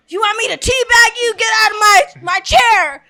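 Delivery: angry dad. Retold or imitated. Dad yelling at cousin in chair.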